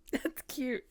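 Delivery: amused. Real, not acted. Charmed by the moon die.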